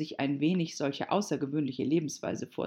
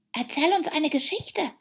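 high-pitched hare voice; high, eager creature; March Hare; 12 semitones higher, 4 dB louder than usual. A narrator's reading. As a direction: demand it in a high voice. March Hare figure demands story.

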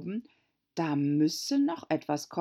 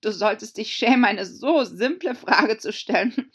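loud, higher, fast telling-off; scolding Gryphon; 5 semitones higher, 8 dB louder than usual. Acted. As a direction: shame the listener briskly and loudly. (lauter, schneller Tadel)

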